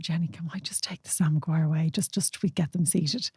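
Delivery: hushed, soft request in someone's ear; retold discreet whisper. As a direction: whisper it like staff not wanting to be overheard. Acts staff member's discreet request, whispered.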